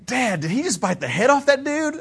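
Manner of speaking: put-on high childlike voice of a young son; son's voice. Pastor voicing his young son at the dragon movie, high and childlike. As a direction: play the small boy asking, wide-eyed. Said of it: "Jeremiah goes".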